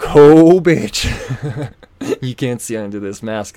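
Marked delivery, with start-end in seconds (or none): 0.0-1.7 loud, higher Oh then a cutting bitch; sarcastic outburst. Retold or imitated. Retelling his reply to the cashier, loud and scornful. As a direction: spit it at her in disbelief. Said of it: "I'm like".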